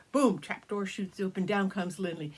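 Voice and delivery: plain reading voice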